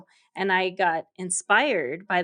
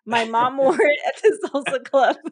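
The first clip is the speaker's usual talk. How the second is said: words bubbling with real laughter, louder; laughing while talking; 5 semitones higher, 6 dB louder than usual. Real, not acted. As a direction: laugh through the line as you tell it. Funny microphone costume story.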